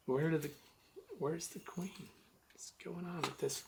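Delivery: small, high, very soft bewildered voice; mock puzzled searching. Beekeeper imitating owners searching for the missing queen, mock puzzled. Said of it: "they were looking around and they're going".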